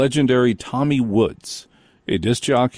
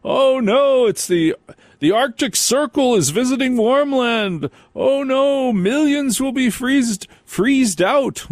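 much higher, panicked doom-mongering forecaster; over-the-top alarmed radio announcer; hysterical weatherman parody; 12 semitones higher than usual. Retold or imitated. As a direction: ham up a terrified storm warning. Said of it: "we didn't go".

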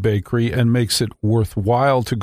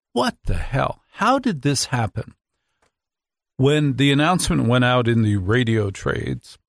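usual speaking voice

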